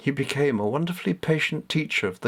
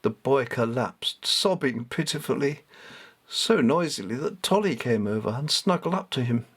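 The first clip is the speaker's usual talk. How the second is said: ordinary delivery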